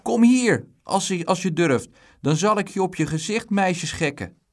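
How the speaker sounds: put-on loud, high boyish threat; angry shout back. A narrator's reading. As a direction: yell back furiously, daring him. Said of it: "Dick werd nijdig".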